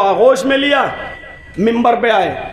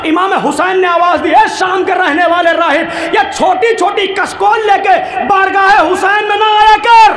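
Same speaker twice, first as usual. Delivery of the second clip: thundering preaching; 6 semitones higher than usual. Acted. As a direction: boom it out as the imam scolding. Husain's voice preached at climax.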